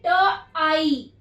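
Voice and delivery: ट and आई drawn out slowly as separate sounds; sounding out slowly. Shown as a demonstration. Teacher sounding out ट आई slowly for young learners. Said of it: "तो कैसे पढ़ेंगे".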